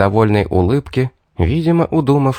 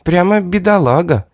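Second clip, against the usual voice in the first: high mock-sympathetic jeer; mock the poor thing; derisive, exaggerated pity; scornful snort; 8 semitones higher than usual. A narrator's reading. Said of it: "зафыркала хищница".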